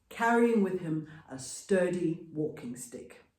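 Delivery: ordinary delivery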